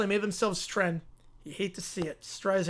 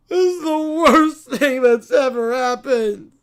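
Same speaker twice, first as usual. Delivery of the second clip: loud, slow sulky whine; bratty child; 6 dB louder, slower than usual. Acted. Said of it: "the Brat Pack".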